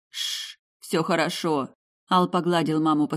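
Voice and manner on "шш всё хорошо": calm a crying mother; soothing hush. A narrator's reading. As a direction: son hushing his mother gently.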